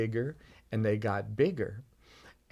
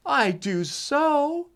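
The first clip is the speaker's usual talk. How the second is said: put-on high, slow child's protest; insistent child; 5 dB louder, much slower than usual. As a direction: dig in stubbornly like a hurt boy. Boy insists to dad.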